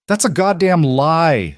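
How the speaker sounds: a man's outraged roar. A narrator's reading. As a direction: roar it out furious and slow. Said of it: "Silas roared".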